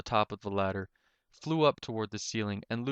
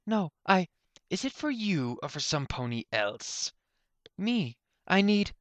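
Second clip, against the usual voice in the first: much higher, stumbling voice; flustered young mare; 9 semitones higher than usual. Acted. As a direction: trip over your words, high. Flustered Soft Heart.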